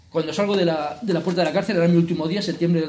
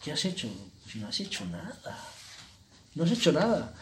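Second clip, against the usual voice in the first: quiet self-reproach; 2 semitones lower, 9 dB quieter than usual. Retold or imitated. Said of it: "me dije a mí mismo".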